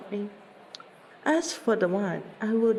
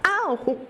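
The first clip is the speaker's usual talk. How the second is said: high, short "ow" of pain; pained yelp; 9 semitones higher than usual. Acted. Dancer reacting to a teased friend, pained yelp. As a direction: yelp as if a toe got hurt.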